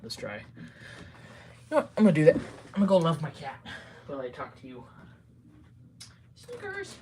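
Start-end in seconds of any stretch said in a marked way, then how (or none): 6.3-7.0 high, soft sing-out to a pet; calling the cat. Real, not acted. Streamer calls the cat in, high soft pet call.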